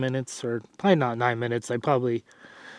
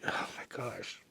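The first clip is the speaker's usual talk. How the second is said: breathy whispered oh my gosh; muttered whisper under the breath; whispered aside; 17 dB quieter than usual. Real, not acted. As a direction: drop to a whisper, embarrassed. Embarrassed at own phrasing.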